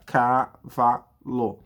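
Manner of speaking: "Cavalo" pulled very slowly into separate syllables; child reading syllables. Retold or imitated. Teacher imitating a child pointing and reading, very slow syllables. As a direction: read "Cavalo" like a child pointing at each letter. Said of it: "ela vai apontar".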